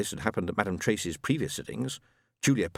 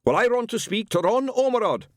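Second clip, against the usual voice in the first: high, loud and irritated; testy; 13 semitones higher, 5 dB louder than usual. A narrator's reading. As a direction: insist with rising annoyance. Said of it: "a little testily".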